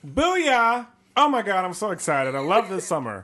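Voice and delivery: loud excited cheer. Acted. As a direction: burst out thrilled. Playing excited kid.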